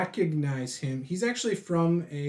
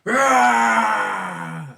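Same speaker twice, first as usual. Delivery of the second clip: loud, high drawn-out roar; angry yell; 9 semitones higher, 10 dB louder than usual. Acted. Game master's brute yells at the adventurer, angry roar. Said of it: "angrily just goes".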